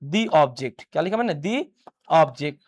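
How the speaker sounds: "the object" with "the" said दी, thee; long "the" before a vowel. Shown as a demonstration. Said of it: "ओ आ रहा है vowel sound". ("the" को दी बोलिए)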